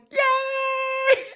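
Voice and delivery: high, loud drawn-out cheer; celebratory cheer. Acted. Coach acting out cheering behind the scenes, high loud cheer. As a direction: squeal a celebratory yay. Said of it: "behind the scenes going".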